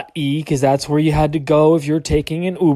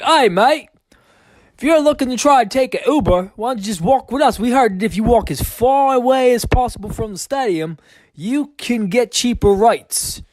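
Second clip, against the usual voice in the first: higher, light mock Australian accent; 9 semitones higher than usual. Aussie stranger inviting him to walk along, mock Australian accent. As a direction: imitate a friendly Aussie bloke, lightly Australian. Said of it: "They're like".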